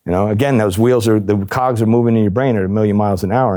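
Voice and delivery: ordinary talk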